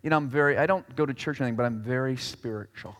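a lightly mocked vague believer; breezy self-justifying quote; imitating a spiritual-not-religious person. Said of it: "Have you ever heard anybody say".

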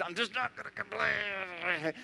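very soft, slow, strained through held-in fury; suppressed anger. Retold or imitated. Believer red in the face, forcing himself not to complain. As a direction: hold it in while red in the face. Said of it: "you get all red in the face".

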